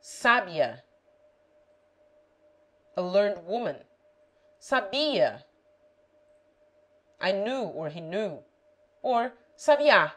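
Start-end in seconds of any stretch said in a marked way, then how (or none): 0.0-0.8 "sábia" stressed on SA; SA-bia, accent on the first syllable. Shown as a demonstration. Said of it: "The accent may make it". 4.6-5.4 "Sabia" stressed on BI; sa-BI-a, accent on the middle syllable. Shown as a demonstration. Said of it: "The accent may make it". 9.6-10.1 "sabiá" stressed on the final A; sa-bi-A, accent on the last syllable. Shown as a demonstration. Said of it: "The accent may make it".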